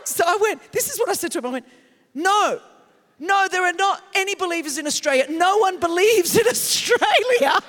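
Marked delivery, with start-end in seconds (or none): 2.1-7.6 loud, high, flustered blurt, slowing at the end with a laugh. Retold or imitated. Starstruck woman answering Jesus actor in a loud, flustered gush. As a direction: gush nonsense out of starstruck panic. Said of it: "I was freaking out so much".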